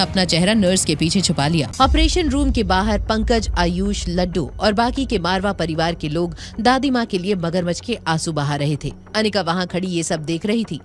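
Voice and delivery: normal talking voice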